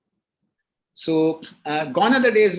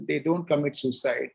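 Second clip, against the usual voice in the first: ordinary talk, nothing marked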